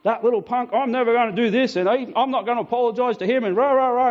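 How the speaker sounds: mimicking the angry bloke's bluster; angry big man. Retelling the surf club grievance, mock angry big man. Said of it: "he was a big man, and he's going".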